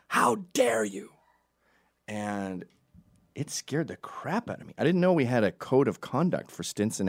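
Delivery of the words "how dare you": mock outraged mother. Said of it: "she started screaming at me".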